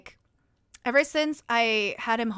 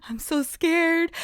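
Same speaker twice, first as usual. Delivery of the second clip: slow, higher voiced fake fright; mock scared; 5 semitones higher, slower than usual. Repeats the mock fear out loud.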